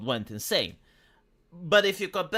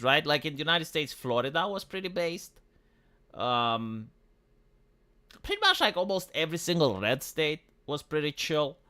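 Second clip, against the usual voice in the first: ordinary delivery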